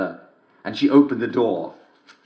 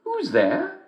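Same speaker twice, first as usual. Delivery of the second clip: put-on high old mother voice; mother asking who knocks; mother's voice. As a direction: call through the door like a mother. Mother answers door.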